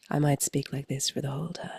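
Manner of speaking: breathy sick-sounding phlegm voice; sexy phlegm voice. Shown as a demonstration. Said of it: "a bit of a Phoebe sexy phlegm voice".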